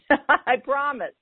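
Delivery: laughing at own procrastination. Real, not acted. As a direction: promise through a laugh.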